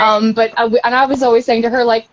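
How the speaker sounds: ordinary talk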